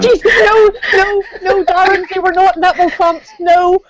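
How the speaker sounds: high, loud laughing protest; flustered denial. Real, not acted. Denying, laughing, embarrassed.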